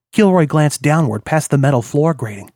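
plain reading voice

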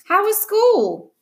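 high-pitched, drawn-out insistent question; insistent demand. A narrator's reading. Sister to brother ignoring her, insistent. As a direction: press hard for an answer, slowly and high.

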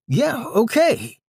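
high, slow, whiny yeah okay. Acted. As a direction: draw it out in a whiny high pitch. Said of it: "his whiny, high-pitched tone".

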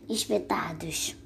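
whisper. A narrator's reading. Reading the Gruffalo's spikes to children, whispered. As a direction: drop to an unvoiced whisper on the last word. Spooky reveal of monster's spikes.

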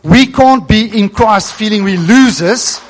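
normal talking voice

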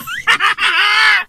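a burst of high cackling laughter; high shrieking laugh. Real, not acted. Comic cracking up at his own line with a high shriek.

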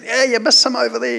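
put-on high, cheeky little boy; bossy child voice. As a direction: pitch up like a bossy kid. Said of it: "the little punk went and sat on the seat".

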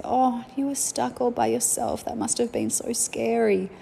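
a little girl mimicking a soothing grown-up; child playing comforter. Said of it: "she'd come in and say".

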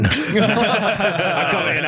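usual speaking voice

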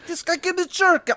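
put-on high, slowing Polish-accented voice of a painter; terrible Polish accent. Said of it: "That's a terrible Polish accent".

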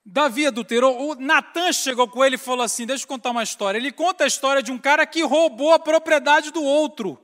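full-force pulpit preaching; fiery preaching. Acted. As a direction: preach it out to the whole church.

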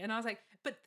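normal talking voice

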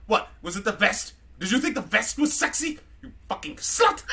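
hammed-up drunk robot jeering; drunk C-3PO. Drunk C-3PO taunting Leia about the vest. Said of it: "C three P O drunk".

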